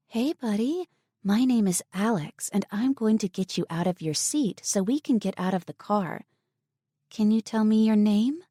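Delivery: soft, sweet tone. A narrator's reading. Alex coaxing the trapped little boy, soft and sweet. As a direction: sweeten it like talking a scared child out. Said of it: "Alex said in a soft, sweet tone".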